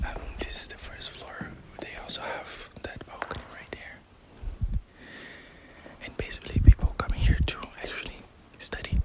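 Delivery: hushed whisper throughout; library whisper. Real, not acted. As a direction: whisper so as not to disturb readers. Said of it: "I will start to speak a little bit quiet".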